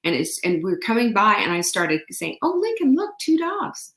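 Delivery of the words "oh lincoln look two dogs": cooing to dog. Retold or imitated.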